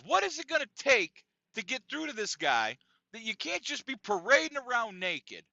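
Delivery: high, loud exasperated complaint; Bob peeved. Retold or imitated. Bob complaining to the director, imitated exasperation. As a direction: act out a man fed up and demanding action. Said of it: "He's angry. He's, he's a bit peeved".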